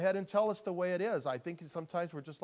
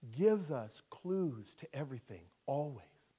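usual speaking voice

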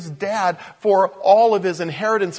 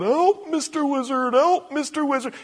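high cartoon cry for help; mock scream for help; 8 semitones higher than usual. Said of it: "he screams out".

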